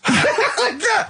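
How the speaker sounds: high burst of laughter; can't hold the laugh in. Real, not acted. Monkey business story.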